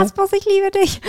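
high, loud swooning cry; gushing. Pretend. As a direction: swoon over Rasmus playfully. Gushing playful declaration.

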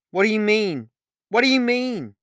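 loud, high-pitched repeated shout; shouted demand. A narrator's reading. Olivia shouts hoping someone might hear and help, alarmed. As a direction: yell it twice, voice leaping up. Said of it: "I shouted".

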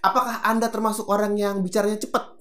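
ordinary delivery

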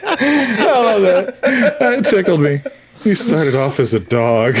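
laughing through the words; can barely get it out for laughing. Real, not acted. Host cracking up at a joke, laughing. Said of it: "That got me".

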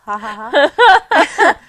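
drawn-out ha ha ha, high and loud; laughing. Real, not acted. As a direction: let a big slow laugh roll out. Bursts out laughing mid-story.

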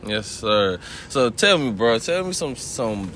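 ordinary delivery

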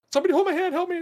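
very high, loud mimicked plea for help; panicky. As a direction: imitate someone terrified in a VR game. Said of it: "she was, like, vibrating and shaking".

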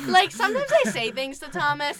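ordinary delivery

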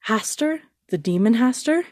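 mild narrated shock; shocked disbelief. A narrator's reading. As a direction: gasp out the demon's name in alarm. Huntsman shocked by the task.

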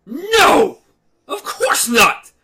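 loud, high outburst of denial; indignant shout. Acted. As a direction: fire back a sharp no, then drag the rest out slowly. Blaine protests the riddle challenge.

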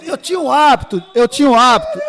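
loud, amused self-defence; shouted insistence. Real, not acted. Pastor defending himself over hosts' teasing, loud insistence. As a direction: shout it over the laughter to get heard.

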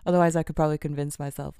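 usual speaking voice

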